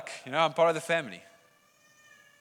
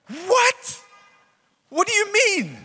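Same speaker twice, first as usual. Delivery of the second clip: much higher and louder, incredulous; shocked exclamation; 8 dB louder, slower than usual. Retold or imitated. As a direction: shriek it in total disbelief. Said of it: "probably something more like that".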